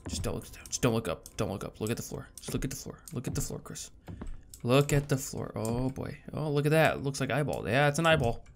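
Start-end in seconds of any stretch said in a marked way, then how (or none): 0.1-3.9 hushed talk to yourself; nervous self-coaching. Real, not acted. Scared player tells himself where to look, hushed. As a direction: talk yourself through it under your breath.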